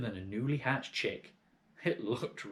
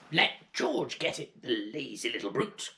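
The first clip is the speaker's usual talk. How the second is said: shouting Grandma; 3 semitones higher than usual. Acted. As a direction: bellow down like a cranky giantess. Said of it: "Grandma shouted".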